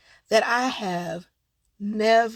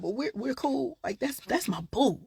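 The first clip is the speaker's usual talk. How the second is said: ordinary talk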